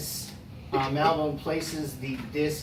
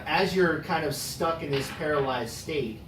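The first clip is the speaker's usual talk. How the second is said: ordinary talk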